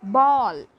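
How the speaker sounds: "ball" loud, with a long "aw" vowel; pronunciation model. Shown as a demonstration. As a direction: punch out "ball" as an example word. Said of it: "let us see in second example".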